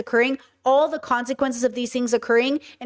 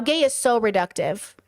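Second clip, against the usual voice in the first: ordinary talk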